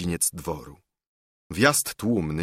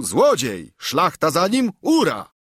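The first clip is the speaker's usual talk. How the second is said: high, raised cry, quoting a heckler; imitated shouting; 7 semitones higher, 4 dB louder than usual. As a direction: call it out like a mocking rallying cry. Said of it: "On krzyczy z tyłu". (naśladowane okrzyki)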